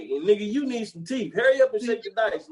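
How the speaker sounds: high, impatient heckling; mock impatient gambler. Retelling the dice scene, high mock impatience. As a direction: hurry him along. Voicing Richard Pryor.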